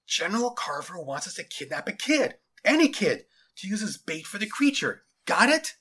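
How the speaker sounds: ordinary delivery, nothing marked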